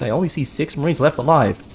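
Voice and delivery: ordinary delivery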